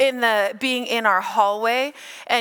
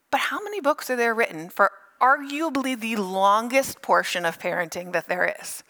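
ordinary talk, nothing marked

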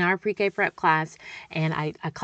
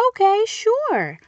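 much higher, louder, slow sing-song; mock mom voice; 14 semitones higher, 6 dB louder, slower than usual. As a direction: answer brightly like a playful mom. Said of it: "And I'm like".

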